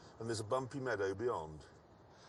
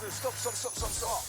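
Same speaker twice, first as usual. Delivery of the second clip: higher, urgent repeated stops; urgent calling; 9 semitones higher, 3 dB louder than usual. Real, not acted. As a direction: call out to halt the car. Calling the car to halt.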